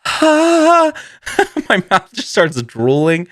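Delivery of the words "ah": high loud yelp of burning pain. Retold or imitated. Mouth on fire, a high loud yelp. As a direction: let out a shrill scalded cry. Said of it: "And I was like".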